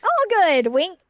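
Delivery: high, loud, playful delivery; mock cheer. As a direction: sing out a jokey approval. Joking reaction to thumbs up.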